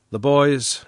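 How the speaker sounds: ordinary delivery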